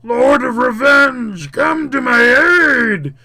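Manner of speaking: high, slow shouted plea; long, loud villain's invocation; howling demon. Acted. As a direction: howl for help in a demon voice. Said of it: "it howls".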